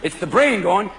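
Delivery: ordinary talk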